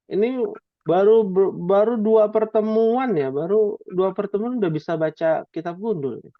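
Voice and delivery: ordinary talk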